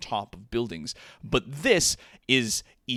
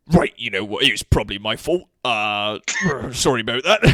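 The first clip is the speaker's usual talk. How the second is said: backing-down stranger; 5 dB louder, faster than usual. Acted. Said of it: "shakes his head and looks at you again and goes".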